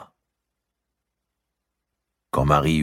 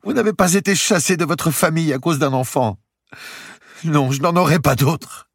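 much higher, louder, desperate; grieving young woman; 6 dB louder than usual. Acted. Marie refusing comfort, much higher and louder grief. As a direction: pour out the despair.

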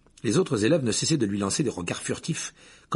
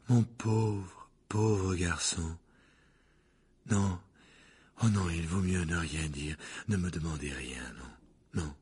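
softer, lower mystic voice sinking to a breath; a seer too shaken to say more, trailing off; Trelawney, fading to a whisper; 5 semitones lower, 6 dB quieter, slower than usual. Acted. The divination teacher refuses to tell the class, fading to a whisper.

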